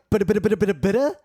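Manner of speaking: choppy glitching digital stutter; stuttery robot glitch. Shown as a demonstration. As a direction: imitate a broken robotic audio glitch. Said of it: "It's like stuttery".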